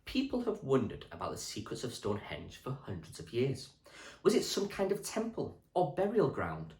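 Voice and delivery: put-on steady storybook reading for children; reading aloud to class. Teacher reading a history book to Year six, storybook voice. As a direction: read the book to the class clearly.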